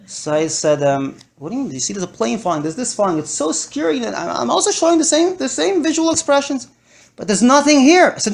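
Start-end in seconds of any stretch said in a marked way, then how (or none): 1.4-8.1 playacting panic, voice climbing higher; hammed-up scared parent; pretend fear. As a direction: fake a growing fright for the child. Acting scared to daughter.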